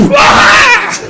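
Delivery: long, very high screeched "pakh"; drawn-out falsetto impact noise; imitated blow. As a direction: screech out the sound of a whack.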